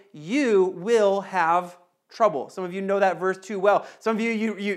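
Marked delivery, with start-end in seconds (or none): 0.1-2.5 slow quote. Acted. Quoting Jesus slowly.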